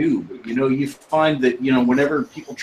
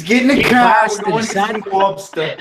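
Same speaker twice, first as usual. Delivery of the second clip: higher, louder put-on Boston accent, laughing; laughing mock Boston; 9 semitones higher, 4 dB louder than usual. Friends trading Boston lines, laughing mock Boston accent. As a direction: ham up the Bostonian while cracking up.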